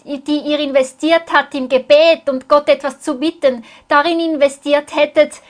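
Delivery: usual speaking voice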